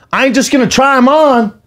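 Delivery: high, insistent voice; high-pitched, emphatic comeback; shoe shopper imitation. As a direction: protest in a high mimicked voice.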